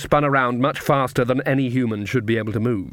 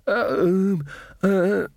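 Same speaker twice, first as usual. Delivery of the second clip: stuttering; 7 semitones higher than usual. A narrator's reading. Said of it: "Magrat stuttered".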